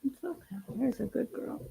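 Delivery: calm the little one down gently. Real, not acted. Mother hushing fussy baby.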